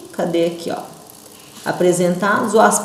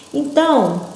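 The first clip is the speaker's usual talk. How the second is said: ordinary talk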